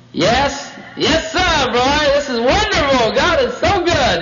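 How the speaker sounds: high, gushing serpent voice; smooth talker piling on the enthusiasm; positive, coaxing serpent. Acted. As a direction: sell it with high, drawn-out delight. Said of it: "He started on the positive".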